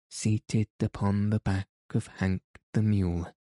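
usual speaking voice

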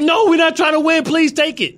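very high-pitched mock plea; mock pleading. Host mimicking a team begging to lose, mock pleading. Mocking losing team's plea.

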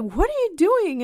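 high, loud, aghast question; mock alarmed mom. Mom catching daughter removing arm hair, mock alarm. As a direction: imitate a mother shrieking in disbelief.

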